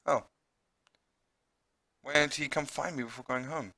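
ordinary talk, nothing marked

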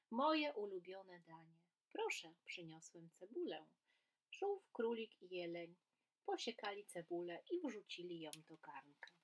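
lively storybook reading with voiced animals; reading to children. A narrator's reading. Teacher reading a story aloud to children, lively and animated. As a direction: read it like a bedtime tale for kids.

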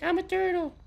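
high, squeaky little turtle voice. Retold or imitated. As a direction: pitch it way up like a cartoon turtle. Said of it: "I'd be like".

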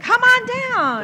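loud high mock game show call; mock announcer shouting come on down; game show host. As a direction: hype the contestant up like a TV host.

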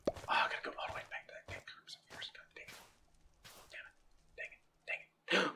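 very quiet, whispery grumbling to oneself; barely voiced cursing at a setback; dejected muttering. Real, not acted. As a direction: grumble under your breath. Muttering to self after losing boots.

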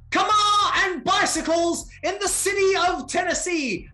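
high, loud crowd-rousing announcer; hyped rallying shout. Pretend. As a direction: cheer them on like a rally speaker. Hyped cheering rally speech.

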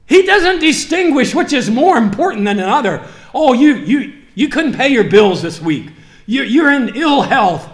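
loud, high, fervent sermon voice; preaching at full force to the congregation; fiery preaching. Acted. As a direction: drive it home to the church.

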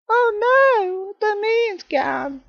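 very high, slow falsetto wail; hammed-up falsetto grief over the moon; mock lament. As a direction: squeak it out like a cartoon despair.

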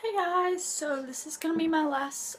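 ordinary talk, nothing marked

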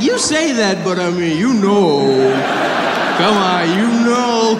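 high, mock-knowing wheedle; coaxing son. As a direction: nudge mom to admit it, hammed up. Son coaxing mother to agree.

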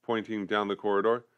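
ordinary delivery

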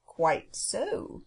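a mildly gentle, unhurried bird character; touch of gentle creature voice; gentle phoenix. A narrator's reading. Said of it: "said the gentle voice of the phoenix".

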